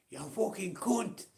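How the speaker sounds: high, loud, slow mock yell at the TV; mock telly rage. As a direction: ham up a raging fan screaming at the screen. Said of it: "You shouting at a telly".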